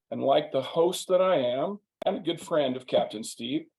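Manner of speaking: usual speaking voice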